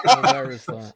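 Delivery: high loud laugh into the question; real laughing amusement. Real, not acted. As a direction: giggle then ask. Laughing at accent tag.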